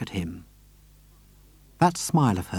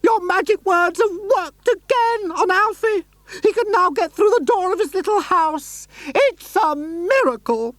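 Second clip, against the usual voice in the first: much higher, crying out with joy; high lady overjoyed; shrill excited lady. Acted. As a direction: squeal the good news. Missus Silver overjoyed.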